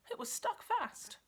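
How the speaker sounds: ordinary delivery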